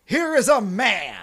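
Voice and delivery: slow, high, drawn-out declamation; grand proclamation. Acted. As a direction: proclaim the tribute with a flourish.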